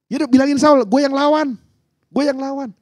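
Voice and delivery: high-pitched, insistent volunteering; volunteer high and eager; eager young challenger. Acted. David volunteering to fight Goliath, eager and insistent.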